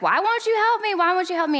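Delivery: high-pitched mock wail of a frustrated kid; imitated frustrated child. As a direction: mimic a child crying out in frustration. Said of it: "and he's so frustrated".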